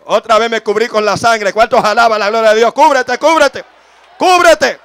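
loud, high revival shouting, building; impassioned preacher's shouts rising in pitch; full-force preaching. Real, not acted. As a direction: drive the congregation to declare it, climbing to a scream. Preaches at full force, leading declaration.

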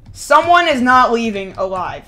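ordinary talk, nothing marked

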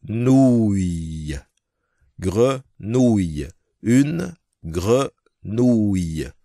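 grenouille very slow with the r gargled in the throat; French teacher drilling grenouille slowly. Shown as a demonstration. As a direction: roll the gre from the back of the throat. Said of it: "lấy ly nước rồi để mua ly nước trong cổ họng".

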